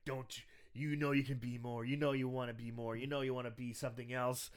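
low, hushed, insistent murmur; soft, secretive coaxing into an ear; mock whispering. Recapper as biker tempting Ricky while wrestling, low hushed coaxing. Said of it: "the biker is whispering into Ricky's ear".

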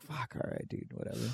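very soft, almost whispered; resigned mutter. Retold or imitated. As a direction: give up under your breath. Own resigned aside to dad.

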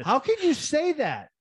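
high, outraged question; incredulous. Retold or imitated. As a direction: demand an answer, stunned. Retold argument with manager.

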